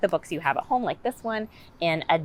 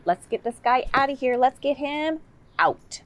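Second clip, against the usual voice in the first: sing-song "out, out"; playful child-directed; 9 semitones higher, 3 dB louder than usual. Shown as a demonstration. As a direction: coax a toddler to say "out".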